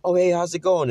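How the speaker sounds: hammed-up eager hello; mock cheery greeting. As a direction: act out the eager text.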